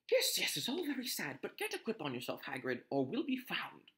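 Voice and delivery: ordinary delivery, nothing marked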